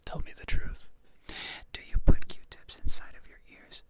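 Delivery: loud breathy ASMR whisper. Real, not acted. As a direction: whisper it close to the ear. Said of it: "same question whispered".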